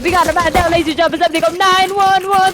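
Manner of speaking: loud high hype call; man down shout. Real, not acted. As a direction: shout it to the crowd. Caster hyping a kill.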